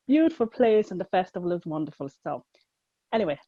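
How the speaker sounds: ordinary talk, nothing marked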